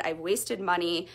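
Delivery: usual speaking voice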